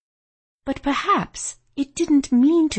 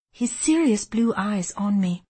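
ordinary delivery

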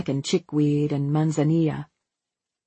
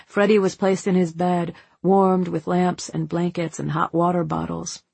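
ordinary delivery, nothing marked

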